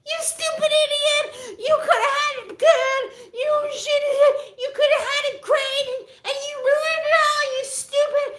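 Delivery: very high, loud put-on woman's shriek; hammed-up shrieking woman; screeching lady. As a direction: rant in a squeaky falsetto. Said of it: "but she's like".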